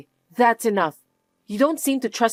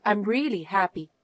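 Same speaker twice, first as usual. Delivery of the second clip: normal talking voice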